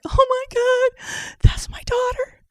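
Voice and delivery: very high, drawn-out squeaky cry of shock; acted-out choked-up realization; tearful shock. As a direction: voice shoots up, slow and overcome. Tearful shocked recognition retold.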